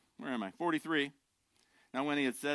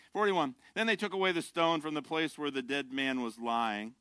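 usual speaking voice

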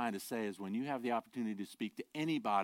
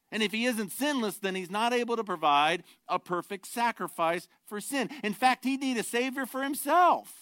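rising, louder preacher's emphasis; preaching; 10 semitones higher, 8 dB louder than usual. Acted. Pastor pressing the congregation, rising and louder preaching. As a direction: drive the doctrine home from the pulpit. Preacher builds doctrinal point.

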